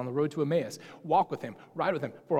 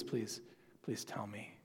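a soft, lower, pleading please; pleading softly; 6 semitones lower, 12 dB quieter, slower than usual. Retold or imitated. Mary begging the gardener for the body, softly pleading. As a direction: beg gently, voice dropping away. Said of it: "and says simply".

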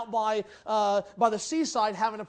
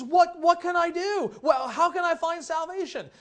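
desperate jailer's cry; 8 semitones higher, 3 dB louder than usual. Retold or imitated. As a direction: beg like a terrified jailer. Jailer desperately asks Paul.

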